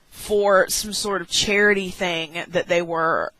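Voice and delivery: usual speaking voice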